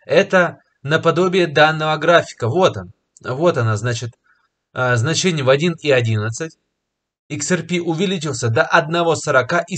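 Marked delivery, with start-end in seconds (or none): none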